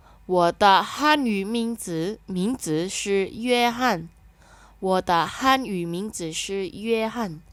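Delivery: very slow, clear Mandarin, syllables spaced; slow model reading. Shown as a demonstration. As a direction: model it for learners to repeat. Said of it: "Kita baca ya".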